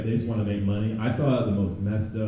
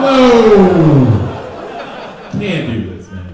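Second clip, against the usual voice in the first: louder startled cry, then settling; mock shocked outburst; 5 semitones higher, 10 dB louder, much slower than usual. Comic reacting to the ad, mock alarm. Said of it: "It's like".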